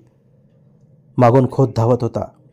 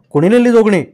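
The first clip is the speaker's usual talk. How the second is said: higher, raised-voice challenge; angry demand; 6 semitones higher, 4 dB louder than usual. A narrator's reading. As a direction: demand the culprit, acting irritated. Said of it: "तेव्हा खोत चिडला". (चिडून चढ्या आवाजात जाब विचारत)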